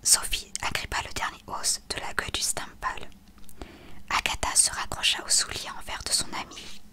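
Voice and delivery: plain reading voice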